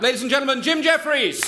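high, loud, shouted introduction; host calling the next comic onstage, shouting; announcer shout. Real, not acted. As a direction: belt the name to the room.

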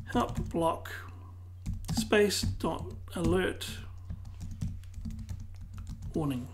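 ordinary delivery

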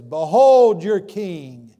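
very loud, slow mocking proclamation. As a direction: sneer it out to the crowd. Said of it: "as a mock".

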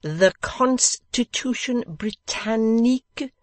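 put-on host teaching a guest like a child; infant-school teacher. A narrator's reading. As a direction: explain it like to toddlers. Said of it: "as if he were teaching in an infant school".

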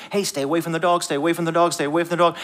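mock parent warning a child off; parent's warning. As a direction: rattle off an acted parental warning. Parent warns child, voiced in story.

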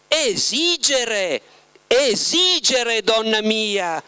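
lento e sorridente, più acuto; sorriso comprensivo. A narrator's reading. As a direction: Esigere detto adagio, con indulgenza. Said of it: "rispose Voland con un sorriso di comprensione". (Voland che corregge Margherita con un sorriso, lento)

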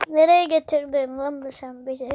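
usual speaking voice

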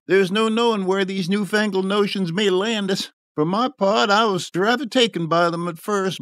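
high-pitched complaining voice; aggrieved tavern man. A narrator's reading. Drinker complaining about his son's lost job, aggrieved. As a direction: grumble on as a wronged father.

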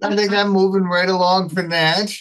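high, loud comic change of subject; joking deflection. Real, not acted. Host jokingly moves on from the newspaper lovers' talk, joking deflection.

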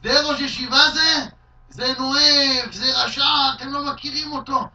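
loud, high, slow jeering insults; mock shout. Heckler shouting at the yeshiva head before his students, loud slow jeers. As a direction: hurl the insults slowly and loudly. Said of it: "מישהו צועק לו".

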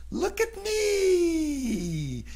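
very high, slow sing-song show-off; girl presenting her makeup to her parents. Acted. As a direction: drag it out proudly like a little diva. Julie shows off her makeup.

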